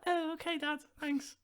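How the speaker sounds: put-on high, small childish thanks; young kid voice. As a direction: play yourself as a little kid. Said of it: "It's like".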